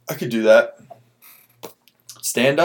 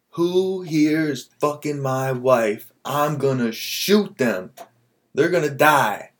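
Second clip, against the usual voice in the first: slow menace; 5 semitones higher, much slower than usual. Retold or imitated. Angry husband in joke.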